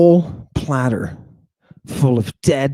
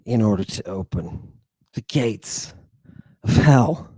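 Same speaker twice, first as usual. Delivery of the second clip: dropping low and soft for the gates of hell; ominous hush; 6 semitones lower, 6 dB quieter than usual. Acted. Lecturer closing on hell, low and hushed. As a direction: lower and softer, spooky.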